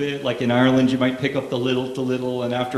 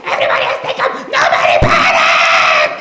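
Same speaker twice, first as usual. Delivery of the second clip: very high, full-blown panicked scream; dad's hissy fit at screaming peak; turned up to eleven; 7 dB louder than usual. Retold or imitated. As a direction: shriek for calm in total hysteria. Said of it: "turned up to eleven".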